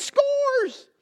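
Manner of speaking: very high, loud victory squeal; squealed kid cheer. Retold or imitated. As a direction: squeal it at the top of your voice like a thrilled toddler. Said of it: "he's all excited".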